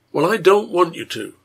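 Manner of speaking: determined. A narrator's reading. Husband forbidding his wife, firm and determined. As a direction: put your foot down. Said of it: "sounding determined".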